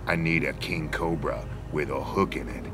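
ordinary talk, nothing marked

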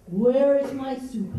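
slow, loud demand; demanding lion. Acted. Lion finds his soup gone, slow loud demand. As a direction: draw it out loudly as an angry lion.

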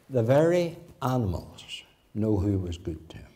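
hushed, breathy whisper of the moral. Acted. Preacher whispering the lesson to the congregation, hushed. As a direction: lean in and whisper it.

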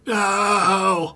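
high, drawn-out no; hammed-up wail of a left-behind child; mock anguished cry. Abandoned son calling after his father, mock anguish.

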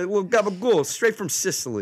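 high, mock Italian-American accent. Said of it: "in an Italian accent".

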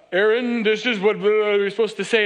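loud, booming mock Moses; hammed-up movie prophet giving orders; Charlton Heston. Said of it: "in his best Charleston Heston".

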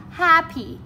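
happy with HAP high and loud, py falling; stress on first syllable. Shown as a demonstration. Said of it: "chúng ta đã gạch hết trọng âm một".

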